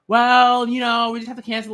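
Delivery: loud, high wishy-washy hedging; mock excuse spiel. Fan mimicking the excuse the leader didn't give, mock hedging. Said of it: "some, like, nonsense and, like, some, like, spiel".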